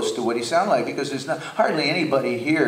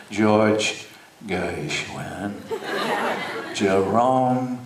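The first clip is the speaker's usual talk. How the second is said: low, drawn-out Upper East Side accent; Harpo; 3 semitones lower, much slower than usual. Shown as a demonstration. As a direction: savour each name in old Upper East Side New York speech. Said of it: "the way Harpo would say it".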